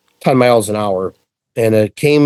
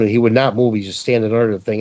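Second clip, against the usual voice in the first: ordinary talk, nothing marked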